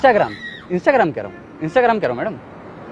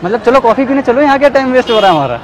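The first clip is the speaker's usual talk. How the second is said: high, loud pushy charm; loud, high eager pitch; overeager invitation; 9 semitones higher, 8 dB louder than usual. Acted. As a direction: sweep her off to coffee.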